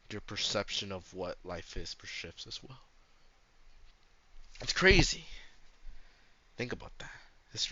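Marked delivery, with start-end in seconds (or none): 4.6-5.2 excited. Real, not acted. Musing aloud, mock-dramatic.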